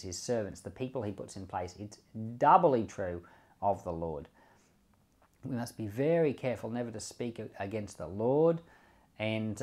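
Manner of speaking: normal talking voice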